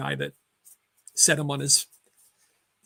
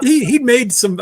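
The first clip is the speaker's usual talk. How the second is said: laughing; 7 semitones higher, 10 dB louder, slower than usual. Real, not acted. Laughing while starting the story.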